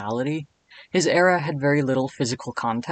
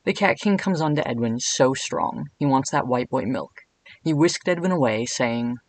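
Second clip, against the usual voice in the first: usual speaking voice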